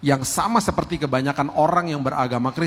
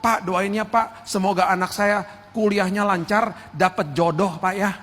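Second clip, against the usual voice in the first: higher, drawn-out begging voice, stretching "Pak"; pleading for favours; 6 semitones higher than usual. Retold or imitated. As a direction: whine a list of requests to the pastor. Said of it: "sembilan puluh persen orang ... ngomongnya begini". (jemaat memohon doa untuk bisnis dan jodoh dengan nada merengek)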